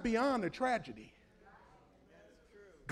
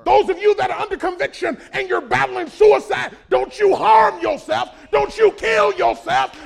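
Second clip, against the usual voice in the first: full-force altar call; 8 semitones higher, 11 dB louder than usual. Acted. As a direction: stop them, one command at a time.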